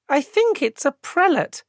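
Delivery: a hint of a curious singer; lightly voiced goblin woman. Squinted and added.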